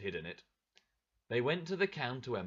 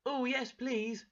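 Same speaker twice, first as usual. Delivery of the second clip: high, slow eager reply; a boy delighted by the offer; eager child; 13 semitones higher, 4 dB louder, slower than usual. Acted.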